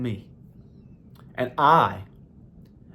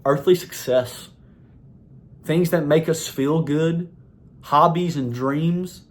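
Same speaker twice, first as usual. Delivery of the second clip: normal talking voice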